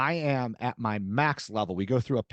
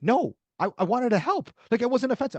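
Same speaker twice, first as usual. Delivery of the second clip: high, earnest protest, a touch louder; upset protest; 11 semitones higher, 4 dB louder than usual. Retold or imitated. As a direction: protest that she only meant to help. Wife protesting in retold argument.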